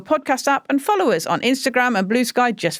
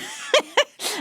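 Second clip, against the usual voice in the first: short high burst of real laughter; 10 semitones higher, 4 dB quieter than usual. Real, not acted. Host laughing as she brings up his acting, real laughter.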